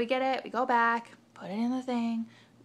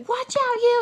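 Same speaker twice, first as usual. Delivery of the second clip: much higher, much louder warning yell; threatening shout; 12 dB louder than usual. Retold or imitated. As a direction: bark it at her with the knife out. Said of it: "she's like".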